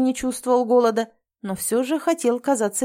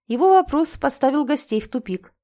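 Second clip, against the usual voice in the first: ordinary delivery, nothing marked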